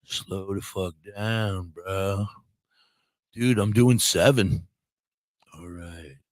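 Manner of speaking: low, soft and slow stoned dude; stoner voice. Retold or imitated. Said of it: "Stoner's like".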